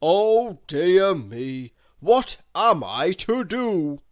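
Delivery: loud, booming fatherly Santa voice; a big jolly man caught in a fix; Santa's dismayed oh dear. A narrator's reading. Santa, stuck in the snow, sighing to himself: loud dismayed Santa voice. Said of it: "and sighed".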